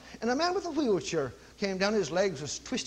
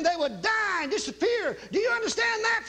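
shout; 10 semitones higher than usual. Retold or imitated. Preacher shouting at daughter.

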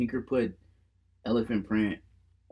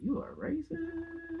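mock accusation; 10 dB quieter, much slower than usual. Mock accusation over shoe taste.

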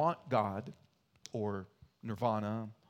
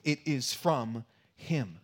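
slow closing line; 3 dB louder, slower than usual. Shown as a demonstration. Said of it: "closing with".

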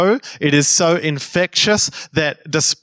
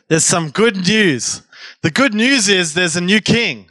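more conviction; 2 semitones higher than usual. Shown as a demonstration. Preacher shows the congregation how to tell neighbours, with conviction. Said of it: "say it with a bit more conviction".

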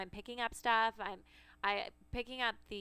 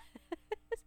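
high laugh; 12 semitones higher, 3 dB quieter than usual. Real, not acted. Laughing at own story.

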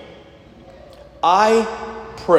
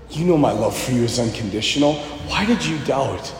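soft, low, almost whispered reassurance; gentle and tender; 7 semitones lower, 7 dB quieter than usual. Retold or imitated. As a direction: speak to him like a loving friend. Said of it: "that's not the way our God speaks".

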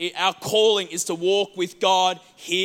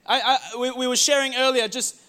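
usual speaking voice

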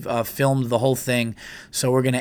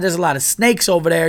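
higher-pitched southern twang; mock southern accent; 5 semitones higher, 4 dB louder than usual. Local woman warning hikers, mock southern accent. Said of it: "keep doing a southern accent".

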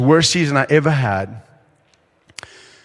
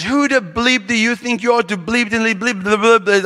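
higher-pitched rush of mock French swearing; angry French cursing; 5 semitones higher than usual. Enforcer confronting a teammate, mock angry cursing. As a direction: imitate an angry teammate's bleeped-out rant. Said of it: "It was bad French".

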